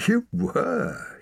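high-pitched teasing insistence; teasing grin. A narrator's reading. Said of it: "he said with a grin".

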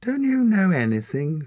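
put-on high, loud sneering girl. A narrator's reading. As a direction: sneer at his ignorance. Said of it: "sneered the girl".

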